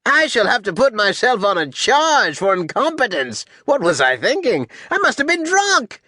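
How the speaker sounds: high-pitched, swooping rant; Alexander. Acted. General rants while kicking the captain, high-pitched. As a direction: rail at yourself in a grating high voice. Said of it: "He has a high-pitched voice".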